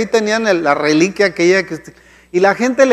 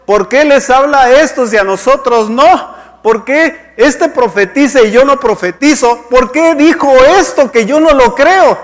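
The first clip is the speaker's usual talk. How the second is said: raised-pitch indignant complaint; imitated grumbling; 6 semitones higher, 4 dB louder than usual. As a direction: mimic people protesting against God, pitch pushed up. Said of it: "Vemos al mundo murmurando, diciendo". (queja indignada, imitando a los que murmuran)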